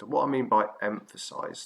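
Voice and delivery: ordinary talk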